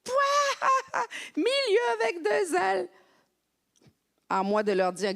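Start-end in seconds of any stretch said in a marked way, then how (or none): 0.0-1.0 high scornful guffaw; mocking burst of laughter. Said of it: "Ça les faisait rire". 1.4-2.9 high, slow, jeering "Milieu avec deux L."; French students ridiculing a teacher's spelling, jeering; mocking students. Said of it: "Ça les faisait rire".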